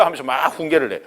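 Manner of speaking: normal talking voice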